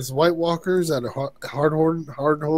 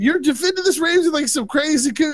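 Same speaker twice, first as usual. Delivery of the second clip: higher-pitched, mock-exasperated Jon; hammed-up Jon venting at her; 11 semitones higher than usual. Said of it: "It's like".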